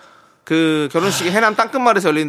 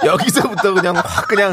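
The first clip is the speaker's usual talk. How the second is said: giggling through the line; can't keep a straight face; laughing; 4 semitones higher than usual. Real, not acted. Actors corpse during take.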